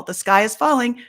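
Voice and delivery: hammed-up alarm; mock panic. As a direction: play Chicken Little crying out.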